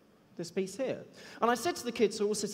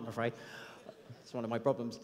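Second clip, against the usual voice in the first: usual speaking voice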